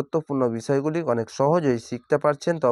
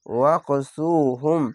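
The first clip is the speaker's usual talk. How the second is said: ওয়াকসুহুম slow, the long vowel stretched one alif; drawn-out recitation; much slower than usual. Shown as a demonstration. Quran teacher modelling a slow, stretched recitation. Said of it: "এক আলিফ টেনে পড়বেন".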